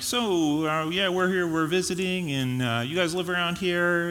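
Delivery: a hint of an awkward small-talker; mock Jeep guy. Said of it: "he's like".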